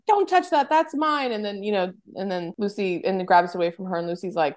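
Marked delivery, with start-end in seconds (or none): 0.1-1.3 high-pitched retold snapping; mock-angry, pitched well up; possessive mother-in-law. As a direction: imitate her jealously warning someone off. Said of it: "the mother jumps up and she comes out".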